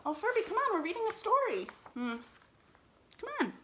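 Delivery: high-pitched pet talk; coaxing the cat. Real, not acted. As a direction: sweet-talk the cat back to you.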